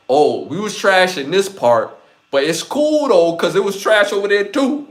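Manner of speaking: loud, rising mock justification; hammed-up excuse, high and loud; mock excuse-making. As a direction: imitate someone excusing bad work, voice climbing high. Voices an artist excusing bad work.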